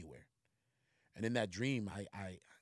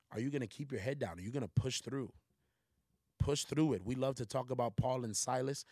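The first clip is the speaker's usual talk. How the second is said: raised, louder preaching voice; 4 semitones higher, 5 dB louder than usual. Acted. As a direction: exhort the congregation with fire. Preaching to the listeners.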